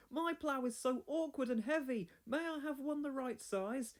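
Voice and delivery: high, timid pleading; peeping. Acted. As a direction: pipe the request up high and small. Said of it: "peeped Thomas".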